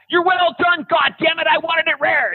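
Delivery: high-pitched rant at a steak; hammed-up irate diner; mock outrage. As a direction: yell absurdly high at the overcooked food.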